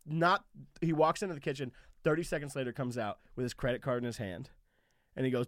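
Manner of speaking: usual speaking voice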